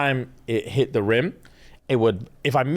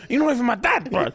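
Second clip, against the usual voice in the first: put-on angry teen rant; 9 semitones higher, faster than usual. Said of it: "screaming into my pillow".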